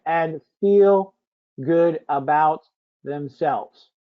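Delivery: each word set apart by long pauses, slow; slow dictation. Shown as a demonstration. Host types the sample product prompt, slowly word by word. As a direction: dictate it slowly while typing. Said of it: "You see how specific I got".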